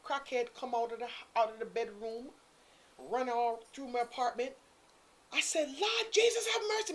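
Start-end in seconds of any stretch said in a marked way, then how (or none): 5.8-6.9 shocked cry. Retold or imitated. Shock at crackhead running out.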